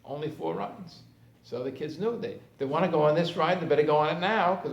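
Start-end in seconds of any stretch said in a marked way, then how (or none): none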